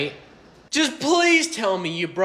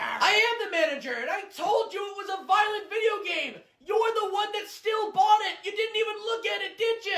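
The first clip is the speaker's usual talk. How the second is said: much higher, shrill-free exploding yell; exasperated shout. Acted. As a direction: finally snap at the customer. Clerk loses temper.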